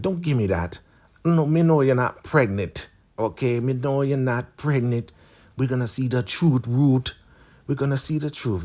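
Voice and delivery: high-pitched, mock scolding elder; voicing Elder Mother Marva. Elder confronts Ruth over the pregnancy test, mock scolding. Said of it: "she gives it to Elder Mother Marva".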